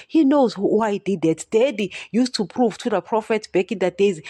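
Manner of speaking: usual speaking voice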